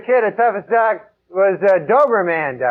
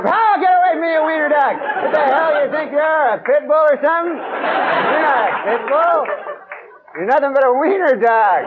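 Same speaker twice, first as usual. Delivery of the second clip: mock scolding; 9 semitones higher than usual. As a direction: scold the little dog like it's a fraud. Mock scolding the wiener dog.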